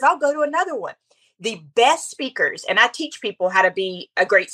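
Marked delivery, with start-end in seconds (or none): 0.0-0.9 high-pitched mimicry of a pastor's accent; Jensen Franklin's accent. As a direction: lightly imitate the preacher's accent, pitched up. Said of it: "his accent".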